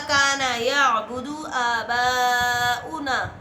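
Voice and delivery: slow, drawn-out Arabic recitation; Quran recitation. Acted. Preacher quoting the Quran to viewers, slow recitation. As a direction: recite the verse slowly with long held vowels.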